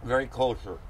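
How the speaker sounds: talking through a mouthful; chewing while talking; mouth full. Real, not acted. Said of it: "I'm sorry with my mouth full here".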